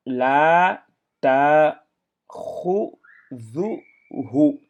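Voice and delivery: loud, careful syllables one by one; syllables drilled. Shown as a demonstration. Teacher drills the pupils on the final word, loud and careful. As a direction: read each syllable out slowly. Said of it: "Hu, la takhuzuhu".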